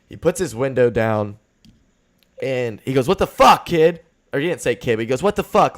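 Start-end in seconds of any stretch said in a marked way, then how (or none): 3.1-4.0 put-on loud, high angry shout at a kid; aggressive yell. Old driver yelling at a kid, angry shout. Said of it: "Like, really aggressive". 5.2-5.8 loud, high angry shout; aggressive yell. Retold or imitated. Old driver yelling from his car window, angry shout. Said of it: "Like, really aggressive".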